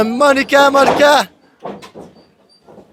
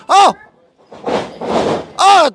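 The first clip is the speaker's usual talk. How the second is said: excited oh; 8 semitones higher than usual. Real, not acted. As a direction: react to the big hit. Big hit in the ring.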